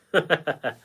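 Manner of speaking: quick laugh after a congratulation. Real, not acted.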